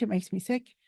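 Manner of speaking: normal talking voice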